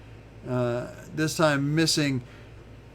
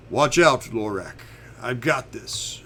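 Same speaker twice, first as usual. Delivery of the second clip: half-raised warning call, loudest at the start; mild acted yell; 2 semitones lower, 5 dB louder than usual. Alec warns Lorak as he charges in, mild yell. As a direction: call out a warning, easing off after the first words. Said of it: "Alec yells out".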